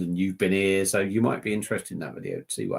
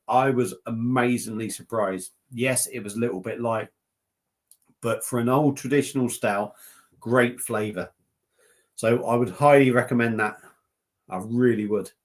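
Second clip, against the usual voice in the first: usual speaking voice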